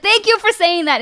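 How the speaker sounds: high-pitched, emphatic thank-you. Retold or imitated. Veteran answering a man who admits he never enlisted, emphatic. Relieved reply to honest admission.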